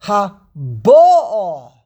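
ha-BA'a, the BA loud and long; stress on the beis. Shown as a demonstration. Teacher correcting the holiday phrase, stress on BA in haba'a. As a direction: hit and stretch the middle BA of haba'a. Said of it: "Put the emphasis on the base".

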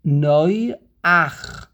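Noach, with a guttural ch at the end. Shown as a demonstration. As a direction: say Noach ending in a throaty ch. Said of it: "the same technique".

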